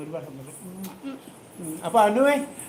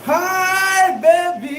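delighted sing-song; 11 semitones higher, 8 dB louder, much slower than usual. Real, not acted. Reacting to surprise gift.

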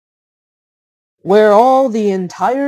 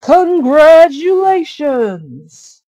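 loud, high, drawn-out cheer; crowd shout; 8 semitones higher, 9 dB louder, slower than usual. A narrator's reading. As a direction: call it out like the whole village cheering. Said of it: "a shout went up from the crowd".

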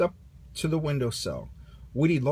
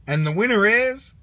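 announcer; 7 semitones higher, 7 dB louder than usual. Acted. Buzz announcing the winner.

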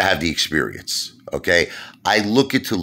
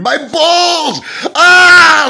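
shrill high falsetto yelps of pain, much louder; mock screaming Lou; 10 dB louder, much slower than usual. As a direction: squeal like a hurt coward. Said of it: "Lou be screaming".

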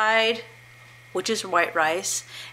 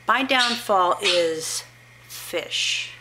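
normal talking voice

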